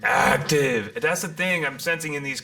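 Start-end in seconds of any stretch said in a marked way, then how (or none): 0.0-1.0 punched louder, falling; insistent repeat; emphatic. Real, not acted. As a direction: hit each word hard. Emphatic repetition.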